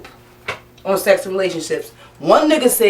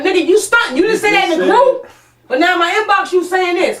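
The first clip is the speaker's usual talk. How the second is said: calling him out; 9 semitones higher than usual. Real, not acted. Host calling out a hypocrite.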